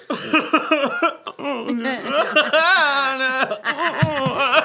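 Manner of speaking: high, loud laughing fit; helpless laughter; big loud laugh; long real laugh. Real, not acted. Laughing at the story.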